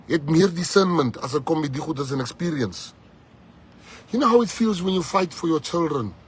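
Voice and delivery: high, loud passionate speech; fiery defence. Real, not acted. Defending Umbiza to the crowd, fiery loud speech. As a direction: defend him with fire.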